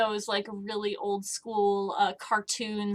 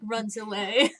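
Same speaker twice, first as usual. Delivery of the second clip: usual speaking voice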